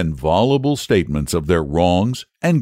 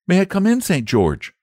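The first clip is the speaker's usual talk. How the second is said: polite; 10 semitones higher than usual. A narrator's reading. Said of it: "said the boy politely".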